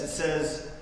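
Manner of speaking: ordinary talk, nothing marked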